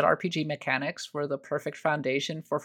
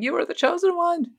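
high-pitched anguished mock yell; hammed-up dramatic wail; Obi-Wan yelling; 13 semitones higher than usual. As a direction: cry it out, pitch soaring to the end. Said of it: "Obi-Wan yelling".

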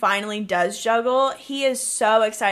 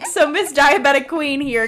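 ordinary talk, nothing marked

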